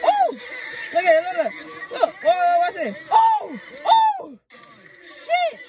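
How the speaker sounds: loud, excited shouting that leaps high; real fight-watcher outburst; hyped reaction. Real, not acted. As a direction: cry out as the punches land. Reacting to fighter getting lit up.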